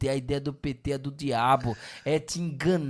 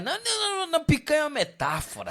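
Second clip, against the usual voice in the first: high nervous mock denial, laughing; squeaky mock denial; 10 semitones higher, much faster than usual. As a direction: falsete zombeteiro de quem nega rindo. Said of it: "será assim". (negação fingida, aguda e risonha)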